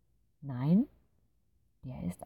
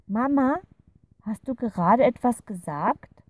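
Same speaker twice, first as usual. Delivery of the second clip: high, loud call to mom; 7 semitones higher, 7 dB louder than usual. A narrator's reading. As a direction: call it across to the next room. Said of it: "ruft Clara ihre Mutter". (laut und hoch nach der Mutter rufend)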